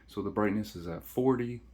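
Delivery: usual speaking voice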